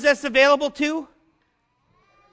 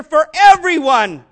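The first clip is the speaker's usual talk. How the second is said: loud slow climax; 3 semitones higher, 8 dB louder, slower than usual. Real, not acted. Climax of preaching repetition.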